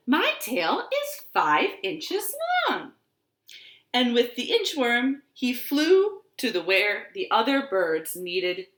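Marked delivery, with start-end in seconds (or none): 0.1-2.9 high marvelling bird voice; robin. Acted. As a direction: wonder aloud up high. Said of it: "said the robin".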